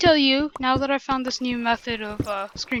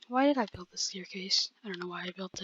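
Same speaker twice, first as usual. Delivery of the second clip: whisper; 4 semitones lower, 8 dB quieter than usual. Real, not acted. Aside while building staircase.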